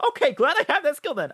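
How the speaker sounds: loud high burst of relief; excited relief. Real, not acted. Streamer reacting to deadly fish, loud excited relief. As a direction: react with glee.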